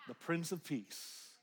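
slow, breathy, hushed; sighed whisper. Real, not acted. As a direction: breathe it out on a sigh.

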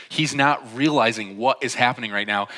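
ordinary talk, nothing marked

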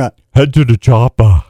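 a weak Arnold voice, only lightly done; Arnold impression. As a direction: lightly imitate Arnold shouting orders. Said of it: "arsenal of voices".

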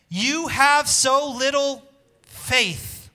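loud, high and very slow rebuke; Jesus rebuking. Acted. Pastor voicing Jesus as he rebukes sinking Peter, loud and slow. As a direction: stretch every word like a stern master lifting him up.

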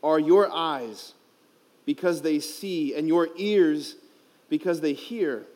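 slow, solemn scripture reading; gospel reading. Acted. Priest reading the gospel to the youth, slow and solemn. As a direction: read the gospel slowly and reverently.

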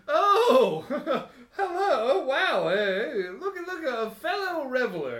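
put-on delighted drunk greeting. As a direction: gush at a fellow reveler through laughter. Drunk billionaire greets party.

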